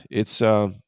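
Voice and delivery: normal talking voice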